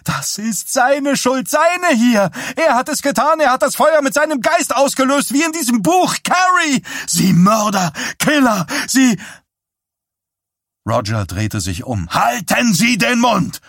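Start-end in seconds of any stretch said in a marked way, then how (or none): 0.0-9.2 very high, loud hysterical accusation; girl screaming accusations at Johnny in hysteria; shrill hysteria. A narrator's reading. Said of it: "mit schriller, hysterischer Stimme". 12.1-13.6 high, loud bellow; father roaring at the hysterical girl; roaring shout. A narrator's reading. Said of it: "brüllte er".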